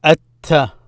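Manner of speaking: At-ta with a doubled T and no L; silent L. Shown as a demonstration. Teacher starting the sun letters, At-ta without the L. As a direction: hold the T in At-ta. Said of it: "I did not pronounce the L".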